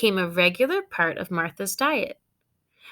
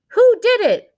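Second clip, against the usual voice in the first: high, loud accusing question; demanding parent; 14 semitones higher, 11 dB louder than usual. Acted. Parent demanding to know who made the mess. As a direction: bark the question like an angry parent.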